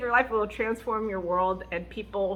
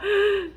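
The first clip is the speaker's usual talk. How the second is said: put-on child's gasp; 11 semitones higher, 4 dB louder than usual. Kid gasps in alarm.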